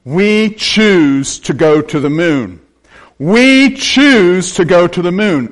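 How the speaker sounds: slow, weighty declaration with drawn-out words; quoting presidential oratory. Retold or imitated. Preacher repeats the president's rallying line to the congregation, slow oratory. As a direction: proclaim each phrase like a famous speech. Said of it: "He goes on and he says".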